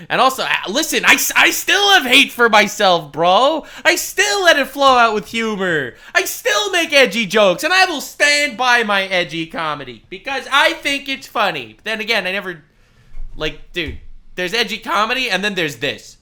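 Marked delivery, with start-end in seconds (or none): 0.1-11.8 louder, higher, mocking defiance; mock defiant confession. Streamer mocks the apology, turning it defiant. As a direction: flip the apology into a brag.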